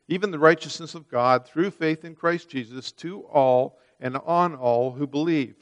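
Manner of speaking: ordinary talk, nothing marked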